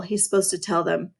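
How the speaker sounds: ordinary delivery, nothing marked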